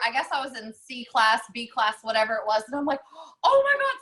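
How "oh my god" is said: sudden high, loud "Oh my God" of alarm; startled cry on realizing she's late; panicked gasp. Retold or imitated. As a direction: jolt of shocked panic, as if just hearing your call. Retold panic in the bathroom line.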